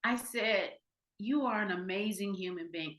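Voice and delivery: very soft, gentle reassurance; hushed and loving, as if holding his hand; soft sincere blessing; tender. Real, not acted. Tender words to weeping man.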